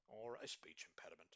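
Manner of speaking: unvoiced whisper, a snide aside. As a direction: drop to a whisper for the jab. Mocking aside about clients.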